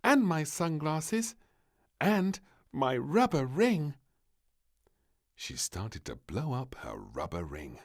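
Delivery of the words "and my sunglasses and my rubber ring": high, loud, slow little princess voice; a small girl proudly listing her treasures; excited little girl. A narrator's reading. Little princess packs for the picnic, high and eager. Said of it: "she giggled".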